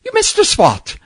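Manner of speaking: put-on old Irish or Scottish janitor scolding the boy over a missed spot. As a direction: put on a Celtic janitor's brogue, chiding. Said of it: "a little Irish or Scottish janitor".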